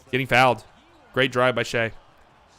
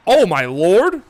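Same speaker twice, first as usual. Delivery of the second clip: stunned exclamation; 3 semitones higher, 6 dB louder, much slower than usual. Real, not acted. Stunned by a wild shot.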